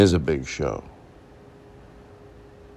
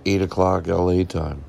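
usual speaking voice

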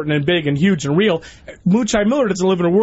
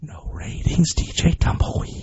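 whispered DJ drop; 9 semitones lower, 5 dB quieter, much slower than usual. Acted. Mock DJ tag drop.

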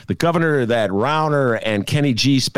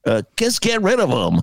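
Donald Trump impression; 6 semitones higher than usual. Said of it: "You were in your head on that second one".

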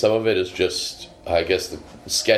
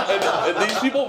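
laughing; 14 semitones higher, faster than usual. Real, not acted. Laughing through amused story.

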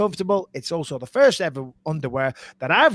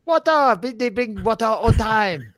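hammed-up Chinese waiter imitation, pitched up; Chinese accent; 4 semitones higher than usual.

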